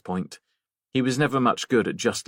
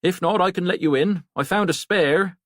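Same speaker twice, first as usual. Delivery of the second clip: a lightly voiced, higher landlord; landlord Harry; 9 semitones higher, 3 dB louder than usual. Harry offering Jen the spare key, lightly voiced and higher. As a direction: keep Harry's easy, higher tone, unhurried on the last bit.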